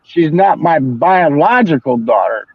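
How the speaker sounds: slow, each word spelled out; stubborn slow correction; slow insistence. Retold or imitated. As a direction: lay it out slowly and firmly. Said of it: "spelling it out slowly".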